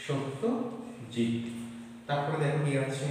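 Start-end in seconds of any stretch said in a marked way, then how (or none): 0.1-1.3 Satyajit drawn out very slowly, falling; slow dictation. Shown as a demonstration. Teacher repeating word slowly for pupils. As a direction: spell out Satyajit at a crawl.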